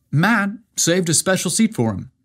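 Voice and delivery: plain reading voice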